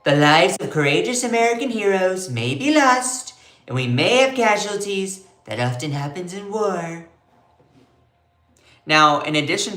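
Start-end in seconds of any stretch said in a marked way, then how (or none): 0.0-7.1 Trump impression. Host reads Trump's war statement in Trump's voice. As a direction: put on Trump's voice for his own words. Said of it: "I can do a good Trump".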